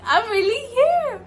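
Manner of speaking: high, loud emotional realization; almost crying with joy at home; teary. Real, not acted.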